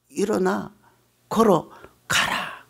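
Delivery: soft, very slow command; solemn command. Acted. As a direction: say it quietly with full authority. Jesus' one command. (조용하고 아주 느린 엄숙한 명령)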